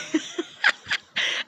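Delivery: breathy high-pitched giggle; high airy laugh. Real, not acted. Laughs after calling sister out.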